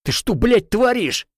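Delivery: high outraged yell; shouting. Acted. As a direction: scream at him. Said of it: "кричу я".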